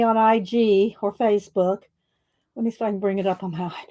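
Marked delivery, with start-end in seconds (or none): 2.6-3.8 winded from running stairs; out of breath, rushing the words. Real, not acted. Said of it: "Oh, I'm out of breath".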